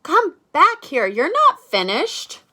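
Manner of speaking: loud high call, slowing on the end; calling after him. Acted. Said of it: "Arthur says".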